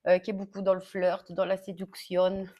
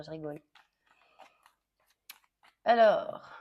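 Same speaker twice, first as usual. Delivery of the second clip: usual speaking voice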